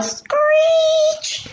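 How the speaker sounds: slow, stretched sound-effect reading; drawn-out screech. A narrator's reading. Tires screeching.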